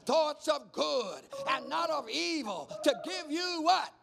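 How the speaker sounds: rising from steady preaching to a high shout; building preaching. Acted.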